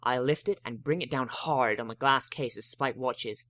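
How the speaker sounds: plain reading voice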